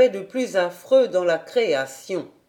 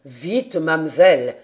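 low voice, louder on the last word; urging servant; 4 semitones lower, 5 dB louder, slower than usual. A narrator's reading. Sébastien hurries Heidi inside, low urgent servant. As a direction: urge her along in a low manservant's voice. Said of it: "répéta Sébastien".

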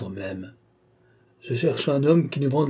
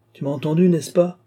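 threatening; 6 semitones higher, 4 dB louder than usual. A narrator's reading. Master threatens pupil.

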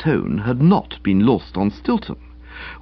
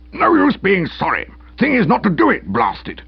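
pompous sputtering colonel; 9 semitones higher than usual. Acted. Uncle snapping at policeman.

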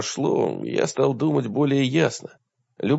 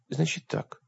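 soft, higher-pitched plaintive voice; 5 semitones higher, 8 dB quieter than usual. A narrator's reading. Woman answering her lover in a story, plaintive. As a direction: say it small and pitiful, softly. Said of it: "жалобно сказала она".

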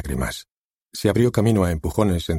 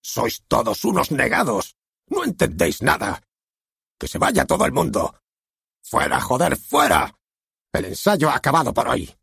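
higher, raging tirade; outburst of anger; 6 semitones higher than usual. Acted. Harvey explodes at the silent actors, raised and raging. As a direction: lash out at the whole cast. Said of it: "dejó que estallara su ira".

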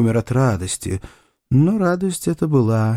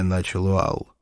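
plain reading voice

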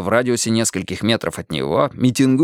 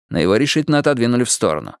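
usual speaking voice

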